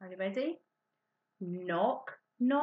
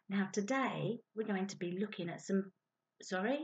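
ordinary talk, nothing marked

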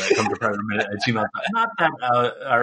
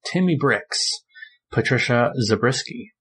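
ordinary talk

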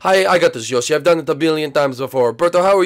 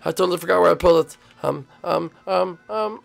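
ordinary talk

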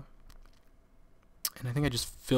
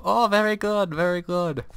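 high-pitched mock Chinese-accented teacher; hammed-up Chinese accent; Chinese accent impersonation; 8 semitones higher, 4 dB louder than usual. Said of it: "that is really how she sounds".

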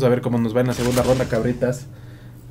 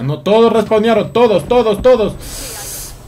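frustrated shout; 9 semitones higher, 6 dB louder, slower than usual. Real, not acted. Enemies respawned, frustrated.

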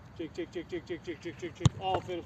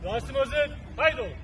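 loud call; 3 semitones higher, 9 dB louder than usual. Real, not acted. Calling out a start.

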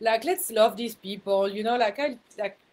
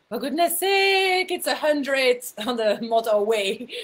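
exasperated outburst; 3 semitones higher, 7 dB louder than usual. Retold or imitated. Fuming at the car going eighty, loud exasperated. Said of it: "typical me in my car".

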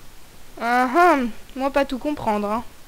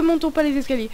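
normal talking voice

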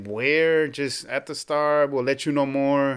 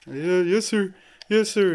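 overwhelmed yes sir; 8 semitones higher, 6 dB louder than usual. Retold or imitated. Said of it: "I started crying".

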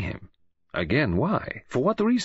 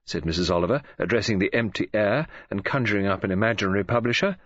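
ordinary delivery

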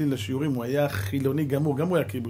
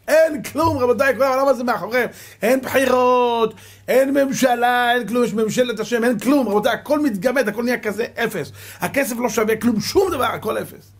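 preaching at full force; 9 semitones higher, 6 dB louder than usual. Acted. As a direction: hammer every "nothing" home to the congregation. (הטפה בעוצמה מלאה, איטית ומודגשת)

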